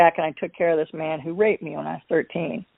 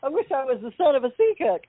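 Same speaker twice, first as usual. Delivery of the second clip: lightly voiced, higher, quick and giddy; mock wistful glee; 6 semitones higher, faster than usual. Joking about her family, a giddy mock wish. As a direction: play it as a jokey delighted wish. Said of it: "I'm like".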